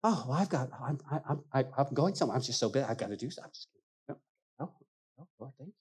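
mumbled excuses trailing off into near silence. Retold or imitated. Brushing off the Lord, fading mumbled excuses. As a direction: stammer out excuses, fading to nothing. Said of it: "we'll go".

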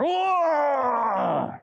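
long high roar of mock rage; boiling-over growl. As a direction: let out a drawn-out angry roar. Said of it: "boiling over type of anger".

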